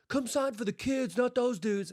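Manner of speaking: high-pitched mock kid's plea; imitated kid calling out. Retelling a kid calling to a player.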